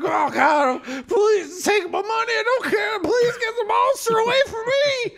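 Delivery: high, loud terrified begging; panicked plea. Acted. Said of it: "He's like".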